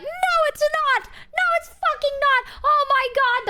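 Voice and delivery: mock the friends' outrage; high indignant no it's not; friends protesting. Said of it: "my friends are like".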